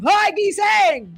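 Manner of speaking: long, drawn-out high shout; wake-up catchphrase shout. Retold or imitated. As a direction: holler it like a show's signature wake-up call. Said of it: "Kaya tumatak talaga sa isip ni Mother B yung".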